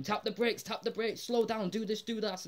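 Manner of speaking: half-hearted panicked shouting from the back seat. Retold or imitated. Family yelling at Homer as car skids, mock panicked shouting. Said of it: "The whole family's shouting at him".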